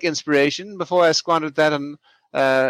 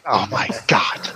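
unvoiced exasperated whisper; 8 semitones lower than usual. Real, not acted. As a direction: breathe it out in disbelief. Reacts to lord missing it.

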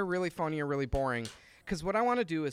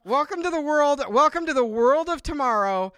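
announcer voice; 5 semitones higher, 7 dB louder than usual. Pretend. Hosts a mock product launch.

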